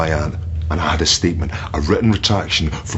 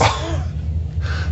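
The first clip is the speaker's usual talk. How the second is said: bitter laugh; 4 dB quieter than usual. Acted. Captor scoffs at the police question.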